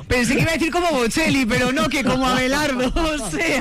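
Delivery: high-pitched talk through real laughter; amused, words riding on laughs; laughing. Real, not acted. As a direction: crack up while teasing a colleague. Cracking up at colleague's joke.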